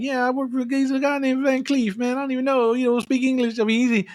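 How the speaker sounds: lifted up high, rambling nervous thug; high goofy henchman voice. Retold or imitated. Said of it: "he's all like".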